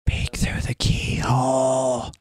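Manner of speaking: slow, drawn-out whisper; playful whisper. Pretend. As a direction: whisper it slowly, as if sneaking a look. Playfully peeking at a door.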